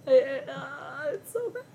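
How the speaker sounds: high squealing laugh-cry; cracking up almost in tears. Real, not acted. Laughing-crying at the names.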